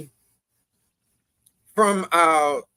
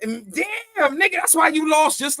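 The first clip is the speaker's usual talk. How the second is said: excited; 10 semitones higher, 4 dB louder than usual. Real, not acted. Laughing outburst.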